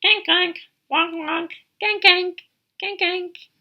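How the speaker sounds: high, loud little kank kank; bright high duckling calls; small duck honking. Acted. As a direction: honk like a tiny duck. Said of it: "They say".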